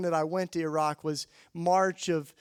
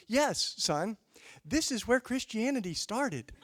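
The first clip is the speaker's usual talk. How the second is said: lightly voiced bishop, pitched up; bishop setting someone straight; 6 semitones higher than usual. Bishop stops an excited visitor in his tracks, gently acted correction. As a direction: pointedly correct a younger man, as a bishop. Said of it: "he stopped me in my tracks, and he goes".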